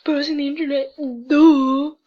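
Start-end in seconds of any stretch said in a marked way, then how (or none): 1.3-2.0 shouted sarcastic duh; mock-obvious yell; loud duh. Real, not acted. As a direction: blurt out duh like it's obvious.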